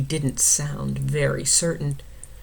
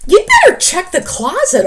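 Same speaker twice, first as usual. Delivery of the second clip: high, loud urging; anxious bear; 14 semitones higher, 10 dB louder than usual. Acted. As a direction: press him to check.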